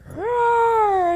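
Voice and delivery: high, smaller attempt at a roar; young cub's roar. Young cub trying his first roar, high and modest. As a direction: try a high little lion roar. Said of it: "it's not as great as the older lion".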